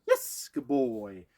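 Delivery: slow, drawn-out happy praise; praise marker. Shown as a demonstration. Trainer showing owners the praise word, slow and happy. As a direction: reward the dog with a stretched, bright yes. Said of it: "I like the word".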